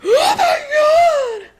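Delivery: a high, loud, stretched-out gasp of horror; shocked shriek. Retold or imitated. Wife finding her husband's hidden book, shrieking in shock. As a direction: shriek it slowly as if the sky fell.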